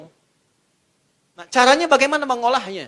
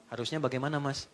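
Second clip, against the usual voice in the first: calm wife; 6 semitones lower, 11 dB quieter than usual. Acted. Wife answers angry husband calmly.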